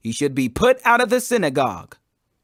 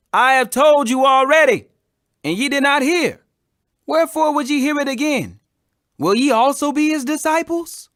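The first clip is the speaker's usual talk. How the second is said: exasperated retort; 8 semitones higher, 4 dB louder than usual. A narrator's reading. Healed man answers Pharisees again.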